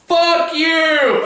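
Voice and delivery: full-volume outraged yell, pitched high; imitated yell. Imitating brother yelling at the screen, loud outburst. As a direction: yell it as loud as you can. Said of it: "that's when my brother yelled".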